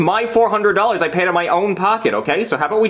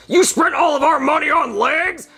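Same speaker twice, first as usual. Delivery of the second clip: high, slow disbelieving boss voice; outraged boss; 10 semitones higher than usual. Acted. NASA boss hears where the money went, high and slow outrage. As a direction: let the boss's outrage rise in disbelief. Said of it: "it's my boss from nasa".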